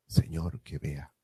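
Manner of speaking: soft, humble plea; hushed plea. Acted. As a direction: let the plea drop almost to nothing. Blind man's plea again. (súplica en voz baja)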